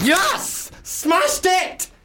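high-pitched triumphant yell; elated, squealing celebration. Real, not acted. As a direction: crow over your own success. Celebrates own accent attempt.

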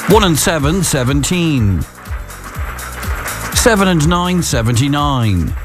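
drawn-out bingo calling, numbers spaced; bingo caller. Acted. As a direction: call each number like a bingo hall.

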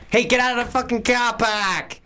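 high, yelled Boston movie cop; hammed-up Boston accent yell. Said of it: "That's from Boston accent".

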